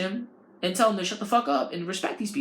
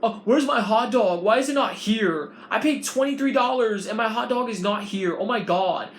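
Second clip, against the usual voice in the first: whiny rude customer; 4 semitones higher, 4 dB louder than usual. Retold or imitated.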